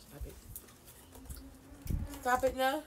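ordinary delivery

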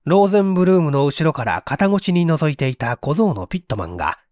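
ordinary delivery

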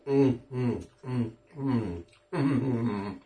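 humming through closed lips; savoring food while chewing; mouth full, chewing. Real, not acted. Seen 1: Took a forkful at -7; lips pressed, cheeks working throughout.

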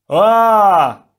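loud high yell of alarm; startled cry. A narrator's reading. Kopaka blown helplessly through the air, loud high cry. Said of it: "he cried".

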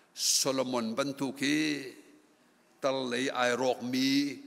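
ordinary talk, nothing marked

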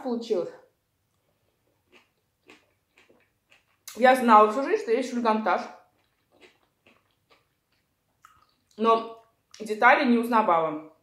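talking while chewing tomato; speaking through food; mouth full, chewing. Real, not acted. Seen 3: Bites tomato at 4.2, then talks with bulging, chewing cheeks.

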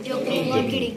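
high, slow, teasing sing-song; playful joking voice. Shown as a demonstration. Teacher models a joking reply, high and teasing. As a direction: tease like a playful friend. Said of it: "मैं तुम्हें मजाक कर रहा हूँ".

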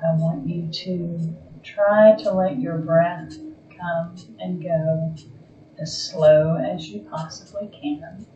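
slow, calm meditation voice with long pauses; guided meditation. Acted. Yoga teacher guiding a resting class into Yoga Nidra, calm guided meditation. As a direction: ease them gently toward stillness, phrase by phrase.

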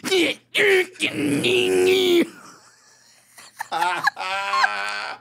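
high, very slow cartoon drawl; South Park voice. Friend imitating the cartoonish sign-off, very slow. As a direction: stretch it like a South Park kid. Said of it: "You sounded just like a South Park character".

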